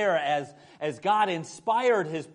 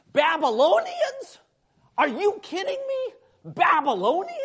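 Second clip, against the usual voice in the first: much higher, louder, slowed mock disbelief; incredulous prophet; 7 dB louder than usual. Pastor voicing Habakkuk as Jim Mora, hammed-up disbelief. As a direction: echo the coach's rant as Habakkuk. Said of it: "I have that image of Habakkuk".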